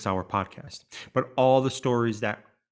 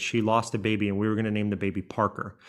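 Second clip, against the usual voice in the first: normal talking voice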